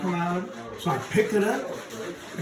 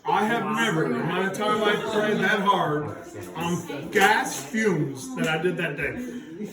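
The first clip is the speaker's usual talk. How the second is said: normal talking voice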